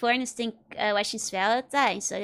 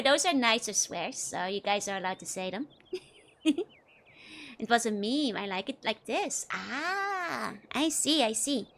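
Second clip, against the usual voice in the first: ordinary talk, nothing marked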